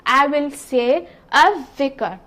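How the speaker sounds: slow, "al-dhikr" with lam silent and a doubled dhal; slow correct pronunciation. Shown as a demonstration. Teacher slowly modelling the right sun-letter form. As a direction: draw it out, "al-dhikr" as "adh-dhikr".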